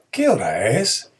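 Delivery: rising question intonation; just ask the time; teacher contrasting a question. Shown as a demonstration.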